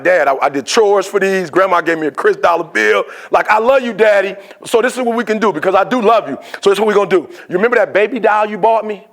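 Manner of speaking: put-on insistent child making a case; pleading little girl. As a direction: bargain with Daddy like a child. Little girl protests, bargains.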